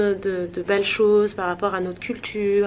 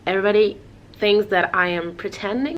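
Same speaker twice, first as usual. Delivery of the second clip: her English-speaking tone. Shown as a demonstration. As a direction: slip into the tone she uses for English. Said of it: "je vais avoir ce ton-là".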